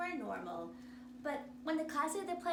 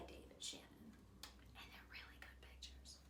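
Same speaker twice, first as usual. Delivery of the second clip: whisper; 16 dB quieter, faster than usual. Real, not acted. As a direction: lean in and whisper it. Whispered aside to listeners.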